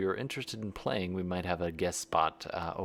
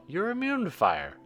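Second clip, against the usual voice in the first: higher incredulous retort; 12 semitones higher, 3 dB louder than usual. Acted. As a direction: scoff in disbelief. Mock dialogue after death.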